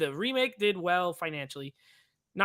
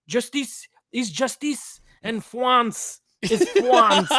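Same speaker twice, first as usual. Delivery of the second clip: slow, laughing mock French accent; ridiculous French accent; 3 semitones higher, 5 dB louder, much slower than usual. Friend quoting the press conference line, laughing mock French accent. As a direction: drag it out like a pompous French inspector. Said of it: "the most ridiculous French accent".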